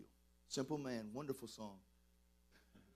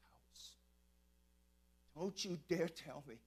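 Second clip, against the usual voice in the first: normal talking voice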